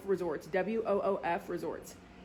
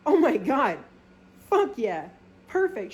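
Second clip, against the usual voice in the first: higher and louder, thrilled; excited; 4 semitones higher, 5 dB louder than usual. Retold or imitated. Told the dog has a room, excited gushing. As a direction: gush with delight.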